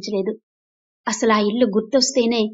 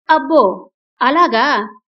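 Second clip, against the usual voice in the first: raised, mocking exclamation; loud, wild shouting; 3 semitones higher, 6 dB louder, much slower than usual. A narrator's reading. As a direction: burst out loudly in scornful disbelief. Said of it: "గట్టి గట్టిగా అరిచింది సుచేత". (గట్టిగా, వెటకారంగా అరుస్తూ)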